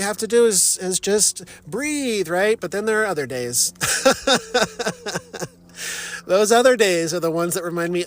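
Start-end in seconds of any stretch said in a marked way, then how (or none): 3.8-5.5 a high giggle; laugh. Real, not acted. Joking about being zen, high giggle. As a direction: giggle about the bad days.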